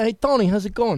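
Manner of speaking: high, loud mock New York Puerto Rican accent; put-on Puerto Rican accent. Shown as a demonstration. Actor shows host his West Side Story voice, hammed-up Puerto Rican accent. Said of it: "So you pretend to be Puerto Rican and Italian?".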